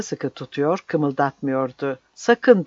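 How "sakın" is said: high, loud warning snapped out; sharp warning. A narrator's reading. Captain gripping the woman's neck, high sharp warning. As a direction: warn her off, sharp and loud. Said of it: "dedi yeniden".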